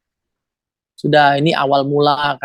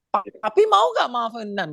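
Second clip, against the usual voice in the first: higher-pitched mock-angry plea; imitated angry demand; 6 semitones higher than usual. Brother asking his father's forgiveness while angry, retold cross demand. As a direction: demand it crossly, without raising the volume. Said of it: "Ngomongnya sambil marah".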